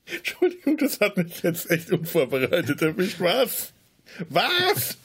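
high squeaky laughing words; cracking up mid-sentence; laughing hard. Real, not acted. Caught off guard, laughing.

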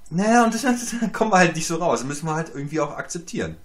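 high, loud, quick shrug-it-off voice with a laugh; mock resignation. Explaining social rank as God-given, in laughing mock resignation. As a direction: toss it off high and amused, dropping towards the end.

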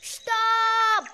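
high, loud call to halt; sharp loud stop call to the group. Acted. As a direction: shout them to a stop. Calling halt while counting steps.

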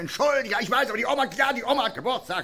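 tipsy pleading. Retold or imitated. Drunk regular pleading at the bar.

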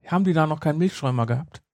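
ordinary delivery

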